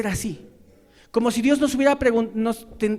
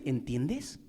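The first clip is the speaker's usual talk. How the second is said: "¿Entiendes?" lower and much softer with a rising tune; quiet echo; 8 semitones lower, 9 dB quieter than usual. Retold or imitated. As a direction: mirror "¿Entiendes?" back, low and soft. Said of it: "demanda algo que no hace".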